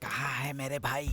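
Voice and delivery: hushed voice. Acted. Man scolded for shouting in hospital, now hushed. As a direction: ask it again very quietly. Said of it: "इतना जोर से क्यों बोल रहा है".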